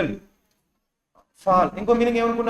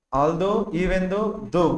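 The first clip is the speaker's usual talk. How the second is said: very slow and a little softer, each word stretched; slow dictation; 3 semitones lower, 3 dB quieter, much slower than usual. Shown as a demonstration. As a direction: spell out although, even though for the class. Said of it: "ఇవన్నీ ఏంటో చెప్తారా?". (చాలా నెమ్మదిగా, ప్రతి పదం సాగదీసి)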